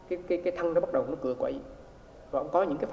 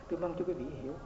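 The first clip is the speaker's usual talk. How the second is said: softer, resigned tôi mong cho quý vị hiểu; soft, resigned wish; soft helpless sigh; 8 dB quieter, faster than usual. Retold or imitated. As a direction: murmur it as if at a loss for words. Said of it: "nói cái giọng mà kêu bằng là không biết làm sao mà trả lời".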